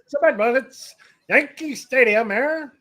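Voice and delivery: put-on high-pitched old-timey newsreel voice; 1930s newsreel guy. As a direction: hype it up like a vintage newsreel announcer. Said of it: "nineteen thirties, uh, uh, n-, newsreel guy".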